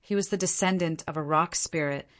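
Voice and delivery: usual speaking voice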